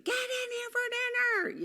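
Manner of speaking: put-on mom screaming the kids in; imitating a frazzled mom's yell. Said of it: "until she starts screaming".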